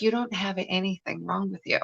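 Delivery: soft, calm doctor voice; hushed, gentle doctor's verdict; quiet doctor. Retold or imitated. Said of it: "he goes".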